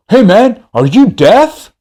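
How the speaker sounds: high, loud mock confrontational yell; angry confrontation. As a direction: imitate an irate man challenging someone. Retold angry man confronts him.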